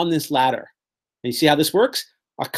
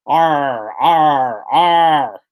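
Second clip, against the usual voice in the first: growled pirate "arr", getting louder; pirate cries; 3 dB louder, much slower than usual. Retold or imitated. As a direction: play a pirate leaping off a burning ship. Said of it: "the pirates jump off of the ship screaming".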